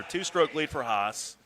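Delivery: normal talking voice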